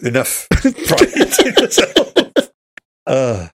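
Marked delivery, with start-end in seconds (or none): 0.0-2.1 high, slow and laughing through the words. Real, not acted. Joking back to his co-host, laughing hard. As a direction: let the laugh stretch the words out. Laughing jokey comeback line.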